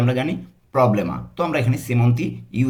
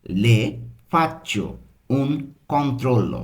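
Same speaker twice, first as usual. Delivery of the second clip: slow Italian, pausing between word groups; slow learner's pace; much slower than usual. Shown as a demonstration. Teacher giving learners the doctor's line, slowly in separate pieces. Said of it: "আমরা কিন্তু যে স্লোভাবে শিখছি".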